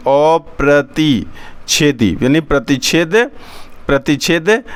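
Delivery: term drawn out syllable by syllable; slow dictation. Shown as a demonstration. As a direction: say अप्रतिच्छेदी very slowly, in separate pieces. Teacher spells out term while writing. (धीरे-धीरे, टुकड़ों में बोलकर लिखवाना)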